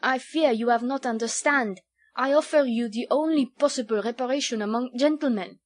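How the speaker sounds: put-on emphatic young Frenchman, thick accent. A narrator's reading. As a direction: insist earnestly in halting French-accented English. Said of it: "in still more broken English".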